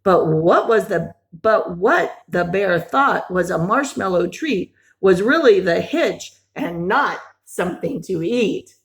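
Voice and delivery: usual speaking voice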